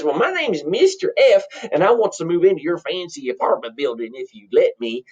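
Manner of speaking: rustic twang, starting high; countrified character voice; country bumpkin. Acted. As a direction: introduce yourself like a farm boy. Plays countrified twin brother.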